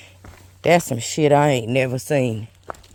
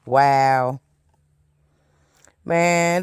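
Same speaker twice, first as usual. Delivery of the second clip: ordinary delivery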